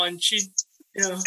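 ordinary delivery

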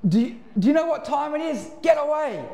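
mock shouted get away; annoyed neighbour. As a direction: yell him off your doorstep. Woken neighbour yelling.

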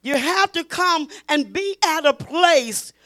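loud, driving preaching. Acted. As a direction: preach it with full force. Preacher at full force.

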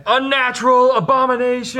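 very high, loud accusing shriek; screaming orcs. Acted. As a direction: shriek insults like an outraged mob. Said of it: "all the orcs are screaming stuff".

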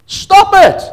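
high, loud rebuke; shouted stop it. Real, not acted. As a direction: snap them out of it loudly. Preacher rebukes grovelling.

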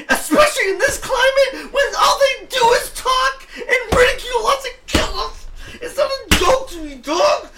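high, strained, near-crying yell; anguished shout. Real, not acted. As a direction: cry out in outrage. Heated rant about rivals.

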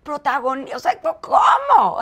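mock astonished, rising to a squeak; shocked disbelief. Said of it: "Me dice". (sorpresa incrédula imitada)

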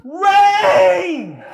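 put-on piercing high scream of a small girl, held long; little girl scream. Father retelling his daughter's cry for rain, little girl scream. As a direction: scream it with everything a three-year-old has. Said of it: "with the high-pitched little girl scream".

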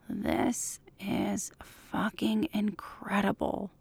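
very slow, soft near-whisper; hushed amazement. A narrator's reading. Mulder takes in Scully's photo, hushed amazement. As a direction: breathe it out word by word, savouring it.

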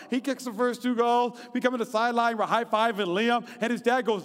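ordinary delivery

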